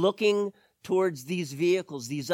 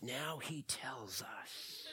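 much softer, slow aside with a little laugh; under his breath; 17 dB quieter, much slower than usual. Acted. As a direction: mutter it to yourself, wryly amused. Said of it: "David says under his breath".

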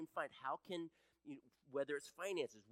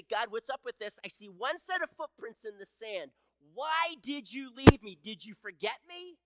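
higher and louder, an anguished cry to God; full-force preaching; 8 semitones higher, 17 dB louder than usual. Acted. Voicing the Footprints prayer loudly.

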